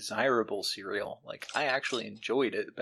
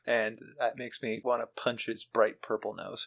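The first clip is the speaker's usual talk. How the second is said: ordinary delivery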